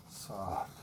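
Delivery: almost breathe the word Soft; hushed soft. Shown as a demonstration. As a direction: drop low and soft on the word Soft. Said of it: "Keep it nice and soft".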